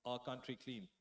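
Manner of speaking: usual speaking voice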